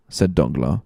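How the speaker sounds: usual speaking voice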